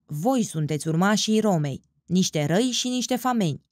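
ordinary delivery

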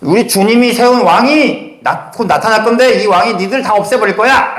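playfully defiant proclamation; triumphant shout. Pretend. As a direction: cry it out as a bold threat. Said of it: "라고 지금 외치고 있는 거라고요".

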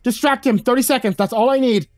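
desperate, high-pitched battle instruction; urgent shouted order. A narrator's reading. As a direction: call it out urgently to teammates. Urgent order called during fight.

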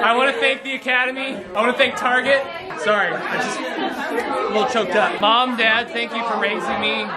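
mock teary award speech; hammed-up Oscar thank-you; acceptance speech.

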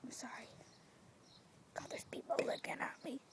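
hushed whispered apology; quiet whisper. Real, not acted. Noticing people watching her, whispering nervously. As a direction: whisper so the neighbour can't hear. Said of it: "I'm talking really, really quietly".

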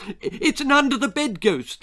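high, loud, stumbling guess; excited child. A narrator's reading. Child blurting another guess to grandma, excited.

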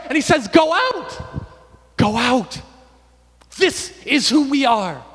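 loud, high, impassioned sermon; full-force preaching. Real, not acted. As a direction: proclaim it to rouse the church.

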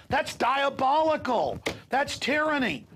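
high, slow, indignant condemnation; outraged denunciation. Real, not acted. The host condemning police orders as tyranny, outraged. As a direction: denounce it with outrage.

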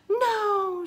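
very high, loud, drawn-out protest; shouted. A narrator's reading. Girl protests the loss of Camelot, shouted. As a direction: cry out in a child's high voice. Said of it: "shouted Annie".

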